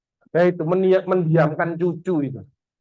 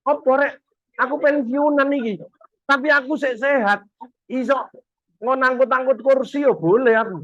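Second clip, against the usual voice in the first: higher, eager volunteer voice; helpful retiree; 6 semitones higher than usual. Retold or imitated. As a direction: offer help like a keen retiree. (pensiunan yang bersemangat menawarkan bantuan)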